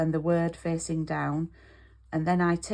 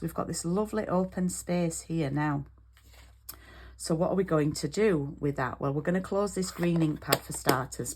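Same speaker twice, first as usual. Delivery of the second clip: ordinary delivery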